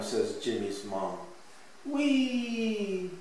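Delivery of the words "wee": high, drawn-out whee; storybook child on a slide, high and gleeful; child's whee. A narrator's reading. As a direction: let out a long playful whoop. Child whoops while playing.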